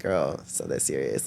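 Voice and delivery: low, soft, unbothered brush-off; dismissive. Retold or imitated. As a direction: wave her worry away, low and soft. Brushing off worried mom.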